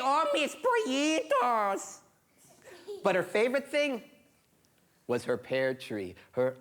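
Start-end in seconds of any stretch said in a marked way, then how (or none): 0.0-2.0 put-on very high, drawn-out old-lady coo; high-pitched fussing old auntie; doting old woman. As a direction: coo to beloved chickens in a high, slow voice. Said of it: "And she'd say".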